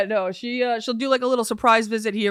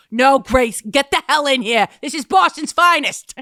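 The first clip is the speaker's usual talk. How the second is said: loud, higher mock mom; mom insisting; 5 semitones higher, 6 dB louder than usual. Mom pulling daughter into a photo with a celebrity, loud. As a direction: order her into the photo loudly.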